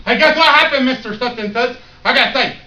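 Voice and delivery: excited shout. Retold or imitated. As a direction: burst into class with the news. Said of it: "Did you run into class and go".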